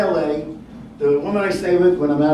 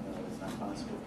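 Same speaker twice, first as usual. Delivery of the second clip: much lower and softer, a hushed mutter; stunned disbelief; 8 semitones lower, 18 dB quieter, faster than usual. Retold or imitated. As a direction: say it under your breath in disbelief. Fireman's disbelief at survivor.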